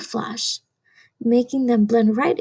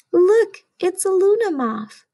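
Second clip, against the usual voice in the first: gasp, then higher delighted reveal; excited discovery; 6 semitones higher than usual. Acted. Showing children the hidden moth, excited gasp. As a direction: gasp as if you just found it. Spotting hidden insect in book.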